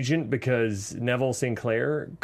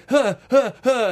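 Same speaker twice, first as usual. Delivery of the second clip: high, louder hyperventilating huffs; panicked panting; 9 semitones higher, 7 dB louder than usual. Retold or imitated. Husband panicking while wife stays calm, panting. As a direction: pant like you're losing it. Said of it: "where I'm like".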